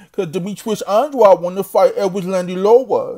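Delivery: put-on lisping R-as-W baby talk; mock speech impediment. Boxing YouTuber mimicking the fighter he's mocking, babyish lisp. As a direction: mimic his mushy R's, pitched slightly up. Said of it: "that's how he talk".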